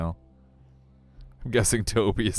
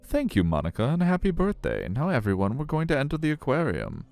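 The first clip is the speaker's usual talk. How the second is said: high, swooping tour-guide voice; friendly aquarium guide; 5 semitones higher than usual. Acted. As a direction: announce the tour in a high, showy lilt.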